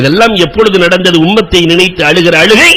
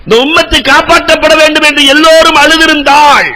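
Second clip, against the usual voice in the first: fervent preaching; 10 semitones higher than usual. Acted. Preacher retelling Bilal's question to the congregation, impassioned. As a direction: preach it with rising fervour.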